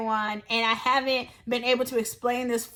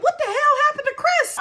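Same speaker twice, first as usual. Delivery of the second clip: much higher, louder disbelief; shocked outburst; 11 semitones higher, 4 dB louder than usual. Retold or imitated. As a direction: gasp at the sight. Finding friend slumped.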